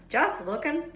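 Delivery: a hint of a shifty, evasive giant. A narrator's reading. Hagrid hiding something from the children, shifty. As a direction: dodge the question. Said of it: "in a shifty voice".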